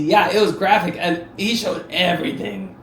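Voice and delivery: normal talking voice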